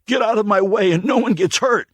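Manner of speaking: high, loud threat; snarl. Acted. Stranger holding the old man hostage, snarling threat. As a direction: snarl it with a knife at his throat. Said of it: "he snarled".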